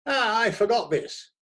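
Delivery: high and loud; hearty editor. A narrator's reading. Editor hands the critic another ticket, loud and high. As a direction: boom it as an afterthought. Barnaby returns.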